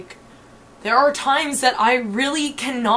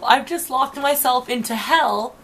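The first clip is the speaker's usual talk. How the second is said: normal talking voice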